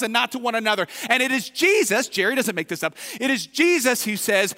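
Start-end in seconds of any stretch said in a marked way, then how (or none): none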